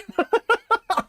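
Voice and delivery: a high-pitched squeal of laughter. Real, not acted. Cohost loses it at the host's meanness, squealing laughter. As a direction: burst into a shrieking giggle.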